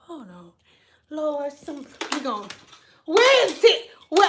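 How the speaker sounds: normal talking voice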